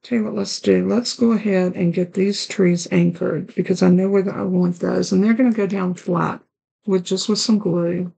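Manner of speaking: usual speaking voice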